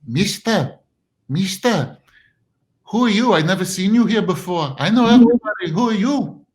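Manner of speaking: a hint of an old lady.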